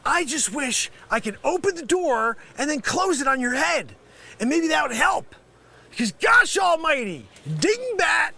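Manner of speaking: comic angry tirade; exasperated berating. Acted. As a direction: lose patience and rant at a fool. Scammer berating the caller.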